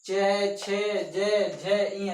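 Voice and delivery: चे, छे, जे, झे, इय said one by one, clearly; consonant drill. Shown as a demonstration. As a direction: spell the palatal row out, letter by letter. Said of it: "इसको उच्चारण कीजिए।".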